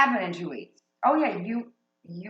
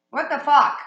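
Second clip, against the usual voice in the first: loud surprised yell; shocked outburst; 4 semitones higher, 8 dB louder than usual. Real, not acted. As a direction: blurt it out in shock.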